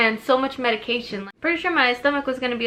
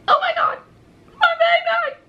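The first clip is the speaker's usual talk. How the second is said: very high, loud tearful cry; crying with joy; 4 dB louder than usual. Retold or imitated. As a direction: sob out love for the baby. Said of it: "We were both crying".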